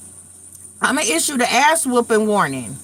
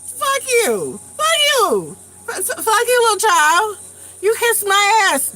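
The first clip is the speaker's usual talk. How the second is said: high-pitched outraged retort; indignant cussing out; 10 semitones higher than usual. Retold or imitated. Grandmother retelling how she cussed at the child, indignant and high. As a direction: cuss him out in a high, offended voice. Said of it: "I had to cuss at him".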